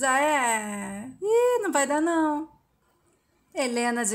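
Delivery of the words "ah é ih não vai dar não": drawn-out mocking tone, rising higher; provocative, playful refusal; taunting refusal. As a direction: tease with a smug sing-song no. Paula refuses, provoking Helena.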